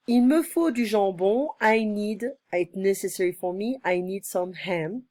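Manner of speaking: ordinary delivery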